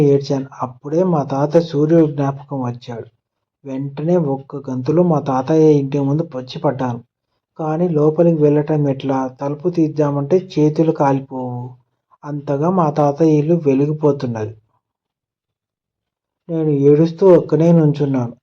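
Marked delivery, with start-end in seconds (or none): none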